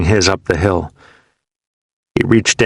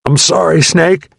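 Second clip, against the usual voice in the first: gurgling apology; 9 semitones higher, 3 dB louder than usual. A narrator's reading. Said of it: "He gurgled".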